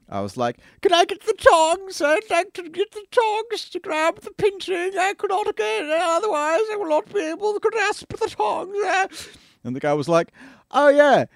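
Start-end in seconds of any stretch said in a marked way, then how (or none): 0.8-9.3 hammed-up fussy Englishman asking for tongs; Monty Python voice. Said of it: "That's like a Monty Python voice". 10.7-11.3 put-on customer's British accent. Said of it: "he was a guy with a British accent".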